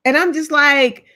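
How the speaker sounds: usual speaking voice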